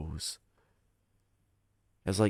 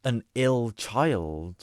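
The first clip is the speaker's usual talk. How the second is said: slow and horrified; 4 semitones higher, 4 dB louder, much slower than usual. Real, not acted.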